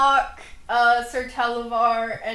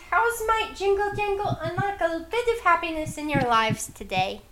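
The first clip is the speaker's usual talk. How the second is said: higher, chirpy goblin merchant greeting; sing-song little goblin hawking her wares; cheerful high goblin; 5 semitones higher than usual. Acted. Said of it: "So, Jingle Jangle says".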